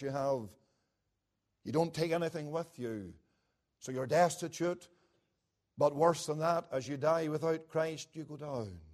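low, soft and grave; a dark, hushed pulpit voice; hushed somber preaching. Acted. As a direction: let the descent sink in quietly.

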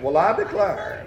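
"Well, I declare" drawled softly in an Appalachian mountain accent; an old mountain folk exclamation, with the "declare" stretched out. Shown as a demonstration. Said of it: "you have to do it with the Appalachian accent".

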